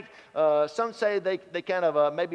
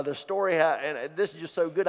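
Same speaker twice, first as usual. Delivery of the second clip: usual speaking voice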